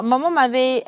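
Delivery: usual speaking voice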